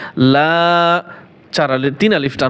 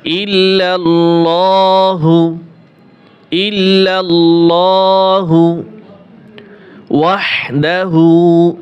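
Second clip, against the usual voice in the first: slow Quranic recitation; 3 dB louder, much slower than usual. Shown as a demonstration.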